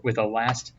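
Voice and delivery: plain reading voice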